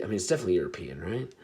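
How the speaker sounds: ordinary talk, nothing marked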